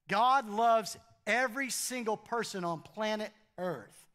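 ordinary delivery

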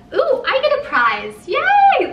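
high, loud squeal of delight; delighted excitement. Real, not acted. Viewer's surprise gift opened on camera, delighted. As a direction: cheer over a surprise prize.

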